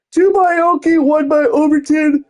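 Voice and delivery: words stretched through a yawn. Real, not acted. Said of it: "Oh, sorry for yawning".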